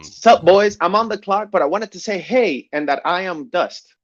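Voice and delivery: loud, high hype greeting; bursting in with a hype hello; excited greeting. Real, not acted. Loud excited greeting joining stream.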